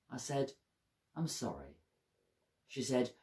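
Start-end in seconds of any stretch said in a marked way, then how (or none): 1.2-1.8 soft and low-pitched; hushed, understated sorry; subdued apology. A narrator's reading. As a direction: apologise quietly, sinking in pitch. Apologising to his crying mother.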